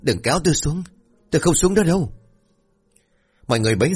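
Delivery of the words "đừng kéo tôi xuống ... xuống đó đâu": rising higher and higher into a scream; panicked cry building in pitch; half-conscious son screaming at the ghost; frantic yell. Acted. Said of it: "Cường gào lớn".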